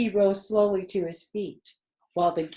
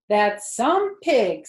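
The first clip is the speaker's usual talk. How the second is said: louder, slow admiring; 3 semitones higher, 8 dB louder, much slower than usual. A narrator's reading. Mrs. Arable admiring Wilbur at the fence. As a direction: savor it, impressed. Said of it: "said Missus Arable".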